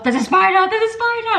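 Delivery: high-pitched panicked cry, re-enacted; retold panic alarm; mock panic. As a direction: blurt it out twice, pitch climbing. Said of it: "like, literally panicking".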